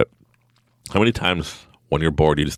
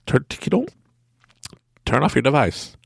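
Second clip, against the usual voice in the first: ordinary talk, nothing marked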